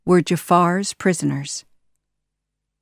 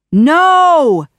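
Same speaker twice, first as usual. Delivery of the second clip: much louder, higher cry of defeat; despairing scream; 10 semitones higher, 10 dB louder than usual. Acted. Jafar crying out as he is trapped, screaming. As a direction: scream as the trap closes. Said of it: "cried Jafar".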